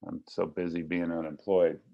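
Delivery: ordinary talk, nothing marked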